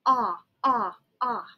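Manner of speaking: repeated ah for octopus; teacher sounding out O; short o sound. Shown as a demonstration. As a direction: model the ah sound. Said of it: "That's the sound that O makes".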